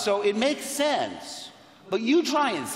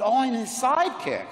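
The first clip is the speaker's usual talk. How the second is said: higher, louder mock dawning; sudden realization; 7 semitones higher, 5 dB louder than usual. Actor assuming his role beside the leading man, exclaiming. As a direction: exclaim it as it clicks.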